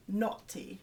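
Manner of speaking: mock British "naughty" with a crisp t; British accent on naughty. Shown as a demonstration. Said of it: "sounds a lot better in a British accent".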